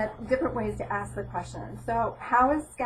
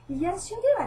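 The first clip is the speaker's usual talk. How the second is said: higher and louder, mock-exasperated insistence; exasperated retort; 9 semitones higher, 6 dB louder than usual. Mother contradicting stepson over the cookies, exasperated. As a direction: snap back at the kid who's caught red-handed.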